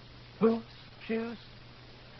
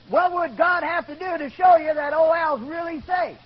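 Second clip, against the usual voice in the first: raised, higher, louder question; insistent challenge; 7 semitones higher, 6 dB louder than usual. Retold or imitated. Gordy challenging doubting Gar, insistent. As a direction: press the question hard, pitched up.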